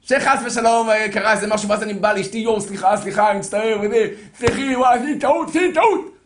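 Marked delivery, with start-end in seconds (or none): 2.4-6.2 apology building from slightly raised to much higher and louder; mock apology to wife. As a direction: beg forgiveness, more frantic toward the end.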